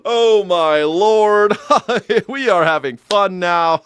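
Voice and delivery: high, loud, drawn-out mock delight. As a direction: cackle and crow with hammed-up glee.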